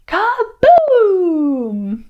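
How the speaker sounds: high, loud drawn-out boom; booming cannon. Shown as a demonstration. Storyteller leading children in the cannon sound, booming. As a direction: explode on the word like a cannon. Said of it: "Can you do that sound?".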